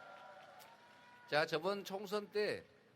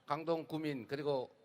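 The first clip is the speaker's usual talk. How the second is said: rally speech shout; 2 semitones higher, 13 dB louder than usual. Real, not acted. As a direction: hail the crowd from the stage. Candidate's rally greeting. (유세장에서 외치는 인사)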